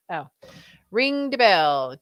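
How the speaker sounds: ordinary talk, nothing marked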